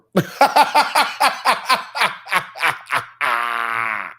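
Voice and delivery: laughing hard at his own joke; long laughter. Real, not acted. Host cracking up at the impression, long laugh. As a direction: burst out laughing.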